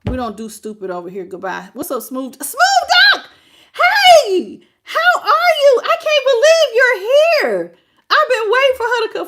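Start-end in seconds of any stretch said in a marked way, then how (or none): 2.4-9.3 high, loud, laughing shriek of delight; excited greeting. Real, not acted. Greeting a longtime commenter who finally joins the live, laughing and shrieking with delight. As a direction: squeal the name like you've missed her for ages.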